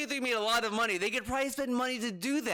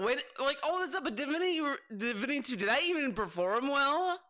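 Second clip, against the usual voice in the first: ordinary talk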